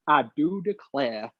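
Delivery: mock Southern drawl, slowed; a light Southern accent impression. Mimicking a coach's fake Southern accent. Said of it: "he's got that proper Southern accent".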